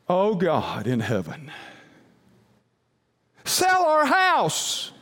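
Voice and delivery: high, slow, hammed-up wail of a prayer; mock pleading prayer. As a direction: cry out to God, over the top. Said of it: "we prayed this very spiritual prayer".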